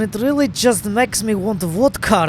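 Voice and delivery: mock Russian accent, lightly put on; joking Russian accent about vodka. Gamer joking about a Soviet spaceplane, mock Russian accent. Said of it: "refrain from trying to do my F P S Russia impression".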